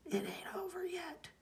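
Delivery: a hushed, breathy threat; menacing whisper. Acted. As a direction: whisper it like a promise of revenge. Reading the villain's final promise.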